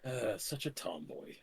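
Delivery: low, soft aside to himself; quiet in-character mumble; under his breath. Acted. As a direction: murmur it low so almost no one hears. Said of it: "he chuckles under his breath a bit and goes".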